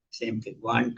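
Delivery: ordinary delivery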